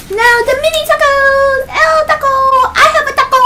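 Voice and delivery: high, delighted squealing over tacos; excited squeal. Real, not acted.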